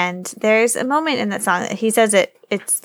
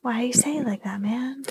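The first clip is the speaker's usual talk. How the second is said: whispered complaint; 5 semitones higher, 7 dB quieter than usual. Acted. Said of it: "mock annoyed whisper".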